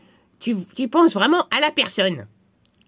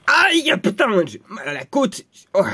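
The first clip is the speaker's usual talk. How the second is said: acted pain; 2 semitones lower than usual. Victim hurt in the rib by magic, pained.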